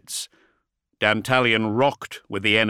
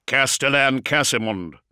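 higher, louder space marine over static; gruff space marine; 4 semitones higher, 5 dB louder than usual. A narrator's reading. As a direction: bark it through a bad vox. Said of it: "scratched the interference-punished voice of a space marine".

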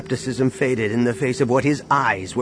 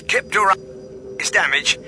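radio message; 8 semitones higher than usual. Acted. Recorded distress message.

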